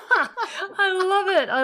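high, loud, slow laugh into the words; delighted laughter. Real, not acted. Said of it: "so I think it sounds just perfect".

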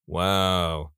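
low, level deadpan; imitated unimpressed monotone. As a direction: say it with no tune at all. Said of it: "said monotonously".

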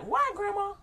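put-on high little-kid voice; child asking. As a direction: ask like a puzzled small child. Children questioning grandma.

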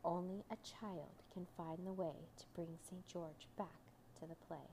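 soft, hushed reading. A narrator's reading. Signpost tells the boy about Saint George, softly. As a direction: say it quietly, fading at the end. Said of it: "The signpost said softly".

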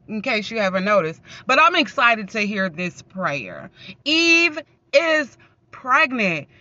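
ordinary talk, nothing marked

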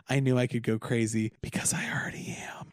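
breathy whisper; whispered confession. Retold or imitated. Journal entry about the role, whispered. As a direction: whisper it like a secret. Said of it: "I was like".

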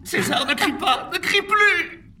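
frantic pleading cry. Acted. Prisoner begging the shouting Caesar, frantic cry. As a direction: beg him to stop shouting.

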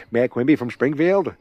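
a lightly put-on Boston Kennedy-style accent, half laughing; Mayor Quimby voice. Podcaster attempting a Kennedy voice via Mayor Quimby, weak Boston-accent impression. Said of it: "That's not even good".

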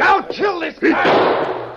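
desperate killer's yell; furious shout. Acted. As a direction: bellow it as you lunge. Villain trying to shoot.

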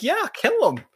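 high, loud egging on; mock bloodthirsty cheer. Host recalling cheering at the screen, mock bloodthirsty cheer. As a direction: cheer for the kill like a fan. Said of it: "And I'm like".

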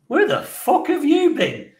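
high, slow incredulous outburst; hammed-up indignant squawk; retold outraged demand. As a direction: demand an answer, voice shooting right up. Acts angry outburst at his dad.

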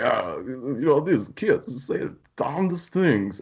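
Bill Cosby impression. Mocking a famous comedian for his podcast friend, slow and high. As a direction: drag it out like a folksy old comic. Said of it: "Bill Cosby's gonna be like".

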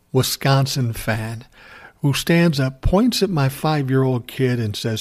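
ordinary talk, nothing marked

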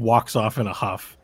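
normal talking voice

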